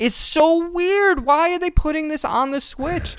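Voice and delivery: squeaky disbelief, very high and slow; sky-high baffled whine; high incredulous. Real, not acted.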